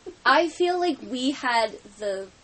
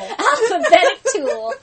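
laughing; 8 semitones higher, 11 dB louder than usual. Real, not acted. Cracking up at the phrase.

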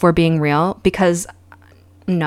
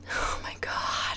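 soft, slow, whispered dismay; horrified whisper; 14 dB quieter, slower than usual. Real, not acted. Vlogger reacting to the family chorus, horrified whisper. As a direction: barely voice it, appalled.

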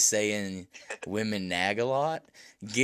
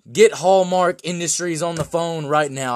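loud producer demand; excited; 5 semitones higher, 10 dB louder than usual. Pretend. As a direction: bark it like a studio boss.

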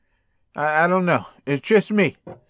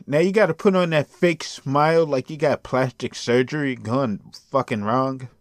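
usual speaking voice

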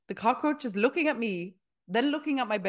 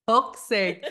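exasperated laugh on the curse; 6 dB louder than usual. Real, not acted. Laughing at her awful timing. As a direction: laugh it out in frustration.

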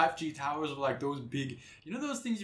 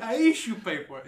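put-on laughing Spanish accent; 5 semitones higher, 5 dB louder than usual. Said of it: "literally in a Spanish accent".

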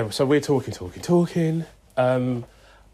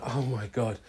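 softer, muttered under the breath; quiet disbelief; 7 dB quieter than usual. Retold or imitated. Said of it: "I was like".

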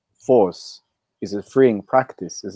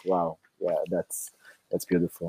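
hushed amazement; 3 semitones lower, 9 dB quieter than usual. Retold or imitated. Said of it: "I'm like".

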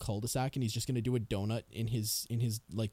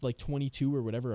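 ordinary talk, nothing marked

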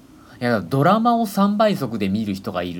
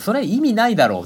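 high, louder exasperated retort; 12 semitones higher, 5 dB louder than usual. Retold or imitated. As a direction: scold in disbelief. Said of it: "それはやめてって言った".

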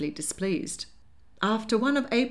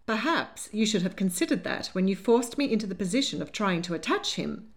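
ordinary delivery, nothing marked

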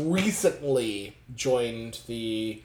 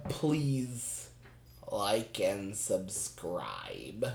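slow motion; 5 semitones lower, 12 dB quieter, much slower than usual. Real, not acted. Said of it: "speak in slow motion".

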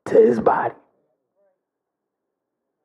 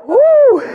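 sudden loud high whoop; high preacher's whoop; 10 semitones higher, 7 dB louder than usual. Real, not acted. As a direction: let out a whoop of fervour. Preacher's exclamation mid-sermon.

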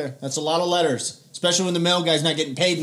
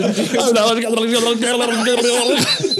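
gibberish chant; 6 semitones higher, 5 dB louder than usual. Pretend. Imitated call chant.

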